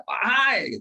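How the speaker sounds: loud calling "Wahai". Shown as a demonstration. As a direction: summon the crowd with "Wahai". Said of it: "seruan yang sambil berteriak".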